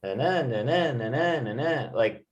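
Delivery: lilting na-na-na, pitch swooping up and down on each NA; sing-song "na, na, na", every syllable rising and falling in a regular wave. Shown as a demonstration. Teacher showing what a twang is like, sing-song. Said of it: "sing-song, like".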